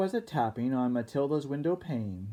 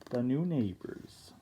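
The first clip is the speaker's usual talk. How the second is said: plain reading voice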